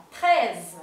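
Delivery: "Treize" ending in a buzzing Z like a little bee. Shown as a demonstration. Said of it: "la Z final siempre como haciendo una abejita".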